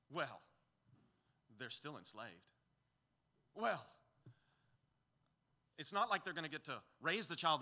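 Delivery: lightly imitated grumbler's objections, sour and nitpicking; mock grumbling. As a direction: play a whiny doubter raising objections. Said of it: "we respond like this".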